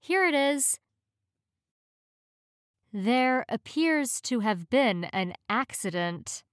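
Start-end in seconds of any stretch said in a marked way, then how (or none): none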